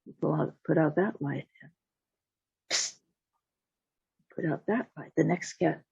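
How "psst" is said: short unvoiced candle-snuff psst; hissed psst. Storyteller imitates cat putting out the candle, short psst. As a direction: imitate a flame being pinched out. He went.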